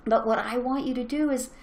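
usual speaking voice

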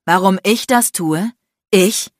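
loud, outraged echo; incredulous anger. A narrator's reading. Man turns the woman's question back, incredulous anger. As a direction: throw the question back, incensed.

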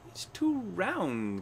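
high, very drawn-out bird cry; cried out. A narrator's reading. Bird judges the haircut, crying out high and slow. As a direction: stretch it out into a high complaint. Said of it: "cried the bird".

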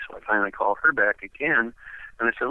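ordinary delivery

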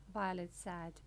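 ordinary delivery, nothing marked

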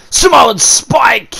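high, slow shouted Samoan Spike; shouted move call. Real, not acted. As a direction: yell the name of the move.

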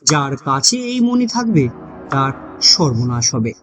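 ordinary delivery, nothing marked

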